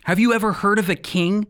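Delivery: usual speaking voice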